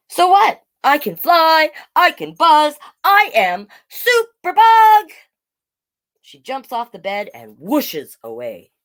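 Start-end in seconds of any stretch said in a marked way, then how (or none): 0.1-5.1 high, loud proud boast; boastful superhero. Acted. Little Jackie boasting to her sister, loud heroic. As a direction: announce yourself as a superhero.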